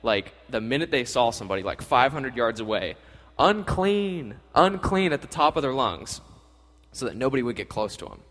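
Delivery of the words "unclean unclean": high, drawn-out call; mock leper's cry, pitched up; acted warning yell. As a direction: call it out as if across a field. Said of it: "they had to yell".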